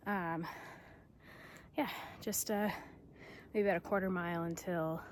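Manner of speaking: winded from the steep climb; panting between words; out of breath. Real, not acted. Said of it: "Whew, I'm out of breath".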